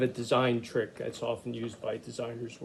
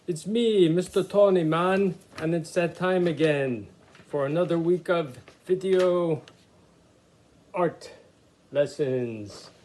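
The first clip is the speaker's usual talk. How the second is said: a light, put-on Jamaican accent, a bit higher and louder; 4 semitones higher, 5 dB louder than usual. Art teacher greets kids in a mock Jamaican accent. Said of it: "I'm trying to do a Jamaican accent".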